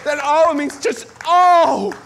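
anguished cry of oh. Shown as a demonstration. Preacher showing the cry. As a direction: let the oh break out of you. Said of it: "that crying out, yearning".

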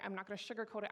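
normal talking voice

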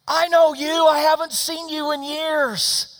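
high, loud squeal of recognition; excited recognition. Retold or imitated. Teenage girl recognizes the waitress, excited. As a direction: shout it high, drawing out the end.

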